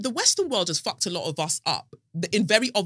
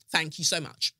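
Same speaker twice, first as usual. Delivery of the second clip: ordinary talk, nothing marked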